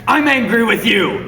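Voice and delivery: slow, high, loud hammed-up anger; stage fury; mock anger. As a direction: overact being angry.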